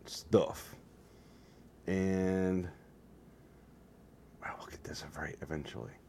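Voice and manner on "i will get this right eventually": whispered under his breath. Real, not acted. Vlogger whispering an aside to himself. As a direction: mutter it to yourself as a whisper. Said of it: "unvoiced whisper".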